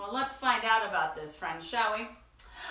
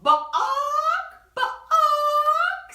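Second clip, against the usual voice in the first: loud, high imitated bawk; chicken cluck; 11 dB louder than usual. Chicken answering duck with a loud bawk. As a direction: cluck like a hen. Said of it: "said the chicken".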